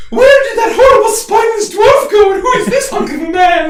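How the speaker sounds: very high, loud squeal; awed crowd member. Acted. Crowd member about the dwarf, high awed squealing. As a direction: shriek in amazement at the hero.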